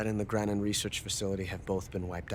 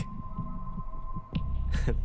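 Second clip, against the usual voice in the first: smug chuckle. Acted. Laughing on the phone about fooling the Chinese, smug chuckle. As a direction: chuckle at the trick you pulled. Gloating over the fake film.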